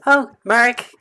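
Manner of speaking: a light Southern belle lilt; sweet Southern belle greeting, lightly done; Southern belle accent, lightly voiced. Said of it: "southern, southern drawl".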